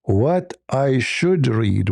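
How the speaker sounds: "What I should read" dragged out slowly, puzzled; slow puzzled question. Retold or imitated. Retelling Muhammad answering the angel, slow and puzzled. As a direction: stretch it out like asking what to read. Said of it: "So obviously he's saying".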